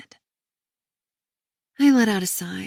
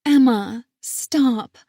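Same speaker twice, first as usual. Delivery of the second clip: louder, crying plea to stop; tearful plea; 3 semitones higher, 6 dB louder, much slower than usual. A narrator's reading. Sister on the phone tearfully pleading with Emma. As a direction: beg her to stop, voice breaking. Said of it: "she pleads again".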